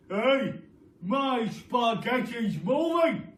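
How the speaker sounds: loud startled put-on husband voice; alarmed cry. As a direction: cry out in disgust at your plate. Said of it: "cried Mister Twit".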